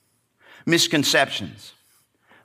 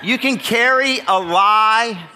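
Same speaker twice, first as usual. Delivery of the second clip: slow, louder and higher; slow preaching; 8 semitones higher, 4 dB louder, slower than usual. Real, not acted. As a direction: lean on each word.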